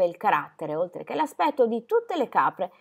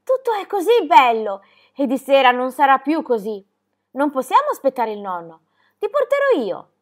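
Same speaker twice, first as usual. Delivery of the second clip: high, loud, breathless-with-joy urging; excited shouting; 4 semitones higher, 6 dB louder than usual. A narrator's reading. Girl urging her friend to come see flowers, excited shouting. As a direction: call out eagerly, pitched up and insistent. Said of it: "le gridò già di lontano".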